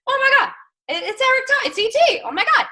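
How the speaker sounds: high, loud excited gush; retold fangirl shriek; star-struck squeal. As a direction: squeal like seeing a celebrity. Star-struck meeting Eric Thomas.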